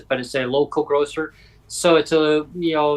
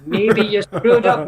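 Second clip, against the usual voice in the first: inner alarm; 8 semitones higher, 4 dB louder, much slower than usual. Retold or imitated. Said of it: "And I thought".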